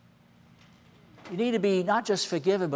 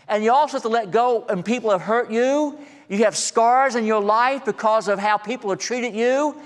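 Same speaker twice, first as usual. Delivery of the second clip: higher and louder, pressing each line home; rising fervent pulpit appeal; preaching at full force; 7 semitones higher, 4 dB louder than usual. Acted. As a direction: preach it out to the whole room.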